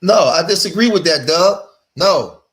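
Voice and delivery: loud objection. Real, not acted. Caller objects over host.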